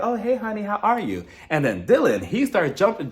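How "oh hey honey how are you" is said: sugary phone greeting. Retold or imitated. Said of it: "she's like".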